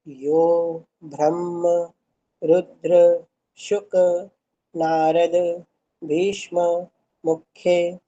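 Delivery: each word slowly and separately; broken into pieces. Shown as a demonstration. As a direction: read word by word, pausing between. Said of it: "देखिए ऐसे".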